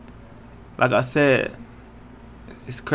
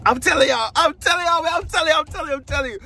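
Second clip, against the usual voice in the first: much higher, bubbling over; can barely hold the news in; high-pitched squeal of excitement; giddy excitement; 12 semitones higher, faster than usual. Real, not acted. Can't contain announcement excitement.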